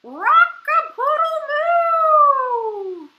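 high, booming, very drawn-out moo-crow; crowing cow. A narrator's reading. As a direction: belt out a slow cow crow. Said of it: "And the cow went".

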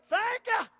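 loud, high shout of thank you; big joyful shout of thanks. Shown as a demonstration. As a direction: belt it out joyfully. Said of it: "You might even do it like that".

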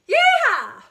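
loud high excited yeah; everyday high cheer. Shown as a demonstration. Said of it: "We use these notes in daily life".

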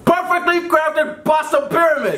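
triumphant shout; excited shout. Real, not acted. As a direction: yell the answer. Guessed right, shouting.

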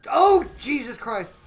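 slow, loud wail of alarm; panicked cry. Real, not acted. Streamer crying out at the crash, panicked. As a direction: cry out as the car hits.

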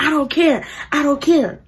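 half-hearted acted yell; retold yelling. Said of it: "Basically just yelling".

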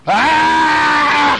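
high, loud shout of fright; a sudden scream of terror; startled scream. Acted.

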